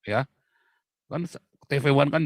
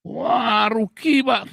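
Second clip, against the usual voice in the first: doubter's protest; 10 semitones higher, 4 dB louder, much slower than usual. Retold or imitated. Voicing a doubting listener.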